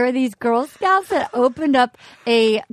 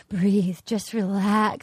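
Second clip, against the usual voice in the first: mock soothing; 5 semitones lower, 5 dB quieter, much slower than usual. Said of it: "he's like".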